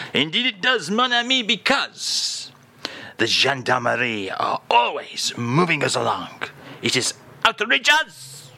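put-on comic French accent rising to a high indignant cry; hammed-up French outrage in the accent; outraged Frenchman. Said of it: "[name] is here".

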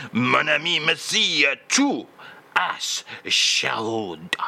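hammed-up French accent, tail whispered; mock French, dropping to a whisper at the end. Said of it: "Short and concise today".

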